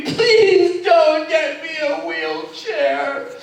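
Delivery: high, loud, slow begging voice; mock pleading. Mother begging her son not to get a wheelchair, high slow plea. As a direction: beg like a tearful old mother. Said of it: "she was pleading with me".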